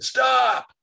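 high, loud choked cry of stop; tearful father crying out. Retold or imitated. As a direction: cut him off through tears. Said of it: "But he was crying".

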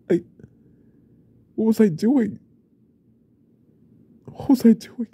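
high, loud horrified outburst; desperate loud cry; anguished cry. Acted. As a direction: cry out in panic at your own deed. Man horrified at what he did.

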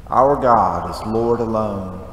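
ordinary talk, nothing marked

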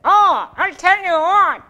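high, slow put-on student voice; Jack's puppet voice. Jack repeats the phrase in his high put-on voice. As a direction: play Jack again, drawing it out. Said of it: "알겠지, 잭?".

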